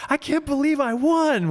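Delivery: high, excited disbelief; amazed racer. Retold or imitated. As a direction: squeal it out like you can't believe you won. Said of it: "he's like".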